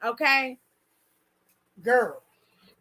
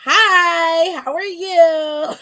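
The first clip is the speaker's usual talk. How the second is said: louder, stretched hi how are you; loud greeting; 2 semitones higher, 7 dB louder, much slower than usual. Real, not acted. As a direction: greet the viewers loudly and slowly. Loud greeting after rant.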